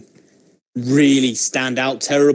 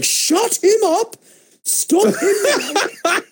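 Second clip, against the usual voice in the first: much higher, slow mock panicked boss voice; Vince McMahon; much slower than usual. Imitating Vince hissing orders to the commentators in a high mock voice. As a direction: squeal it like a frantic boss in an ear. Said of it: "You can see Vince in his ear going".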